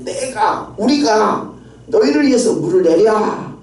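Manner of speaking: high-pitched, self-important proclamation; arrogant boast. Retold or imitated. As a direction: act out a proud leader taking the credit. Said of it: "그게 굉장히 교만한 그런".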